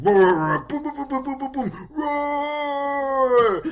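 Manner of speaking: high, loud mimicked explosions ending in a roar; movie sound effects. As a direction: boom it out like a cheap action film. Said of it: "especially if the sound effect".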